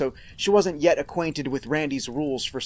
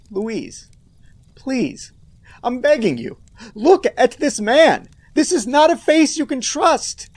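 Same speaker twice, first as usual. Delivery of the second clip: desperate pleading; 7 semitones higher, 6 dB louder, slower than usual. Acted. Pleading with the character.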